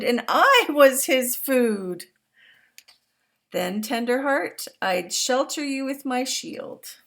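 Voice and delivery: put-on storybook reading to young listeners; reading to children. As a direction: read it out big and warm for the class. Reads picture book aloud.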